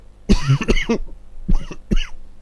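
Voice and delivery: choking cough on a sip; sputtering cough after drinking. Real, not acted. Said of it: "trying to talk and drink whilst almost laying down".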